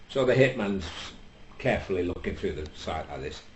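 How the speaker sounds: ordinary talk, nothing marked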